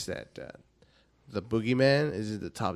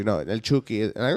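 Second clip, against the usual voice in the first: ordinary delivery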